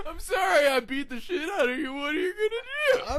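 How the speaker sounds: high, loud laughing jeer; gloating over the beaten fighter, loud and laughing; gloating taunt. Retold or imitated. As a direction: crow it through laughter. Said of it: "continues Frank Mir taunt".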